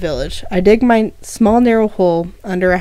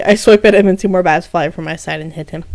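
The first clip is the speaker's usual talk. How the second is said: ordinary delivery, nothing marked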